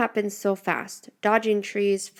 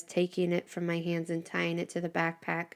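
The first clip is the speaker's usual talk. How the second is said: ordinary delivery, nothing marked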